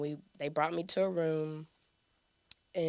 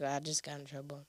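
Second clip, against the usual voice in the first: much softer, small admission; quiet ashamed confession; sheepish; 3 semitones lower, 9 dB quieter than usual. Retold or imitated. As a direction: mumble it like a caught kid. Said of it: "I was just like".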